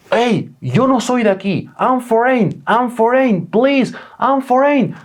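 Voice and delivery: súplica aguda y estirada, en pánico; súplica desesperada. Retold or imitated. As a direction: ruega a gritos mientras corre tras la moto. Gritando tras la moto, suplicando. (suplica a los ladrones, desesperado y agudo)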